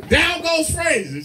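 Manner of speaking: high, slow, laughing shout; laughing mock yell. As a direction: yell it again while cracking up.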